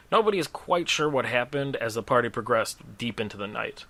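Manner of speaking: usual speaking voice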